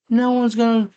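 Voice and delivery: loud, very high leaping yell; high-pitched imitation of a bold girl; mock daredevil cry. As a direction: holler it like jumping off a tower. Girl calling out before jumping.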